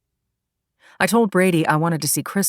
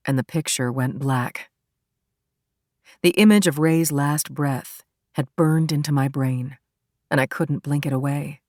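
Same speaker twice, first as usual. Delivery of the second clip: ordinary delivery, nothing marked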